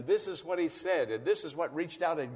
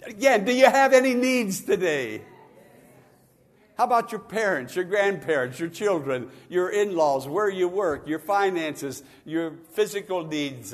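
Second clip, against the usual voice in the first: full-force preaching; 3 semitones higher, 8 dB louder than usual. Real, not acted. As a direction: preach it out to the back row.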